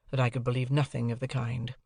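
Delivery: ordinary delivery, nothing marked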